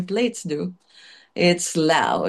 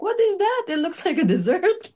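put-on much higher, puzzled old grandmother; 12 semitones higher than usual. Grandmother questioning the chef's plated relleno, high and puzzled. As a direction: voice the grandmother as she squints at the dish. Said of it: "That's what she said".

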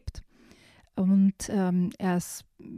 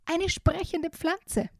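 high, slow squawking parrot voice; startled parrot; 9 semitones higher, much slower than usual. Acted. Parrot shocked by a talking plant, high squawky voice. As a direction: gasp it out like a shocked bird.

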